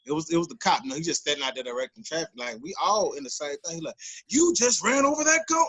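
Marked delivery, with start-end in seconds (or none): 4.3-5.7 high-pitched retold telling-off; pissed-off cop. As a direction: imitate a cop's high, incredulous outburst. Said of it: "he was pissed off".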